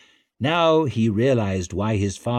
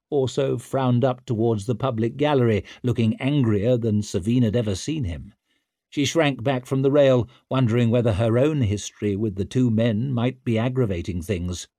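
ordinary delivery

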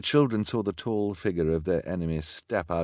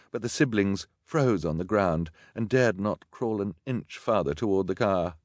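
usual speaking voice

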